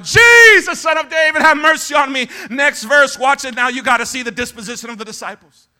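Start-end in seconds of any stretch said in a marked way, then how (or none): 0.0-2.3 loud, high cry from the crowd; desperate-sounding call for mercy; Bartimaeus. Acted. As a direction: shout the plea, starting at full blast. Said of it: "Bartimaeus cried out".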